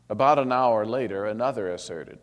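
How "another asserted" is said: ordinary delivery, nothing marked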